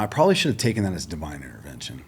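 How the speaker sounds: normal talking voice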